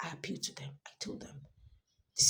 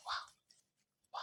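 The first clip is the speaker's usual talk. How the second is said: disbelieving whisper; quiet stunned wow; 11 dB quieter than usual. Retold or imitated. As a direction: breathe the two words out in disbelief. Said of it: "I said".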